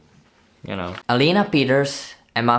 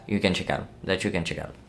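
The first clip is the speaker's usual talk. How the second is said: low, softer trailing repeat; odd delivery; 4 semitones lower, 7 dB quieter, faster than usual. Real, not acted. Recommending films to viewers, oddly said low afterthought. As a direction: drop into a low, quieter afterthought. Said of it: "I don't know why I said it like that".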